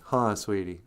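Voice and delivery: ordinary talk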